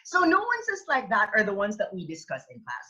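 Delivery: ordinary talk, nothing marked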